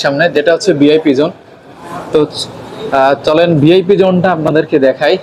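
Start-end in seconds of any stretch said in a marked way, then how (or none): none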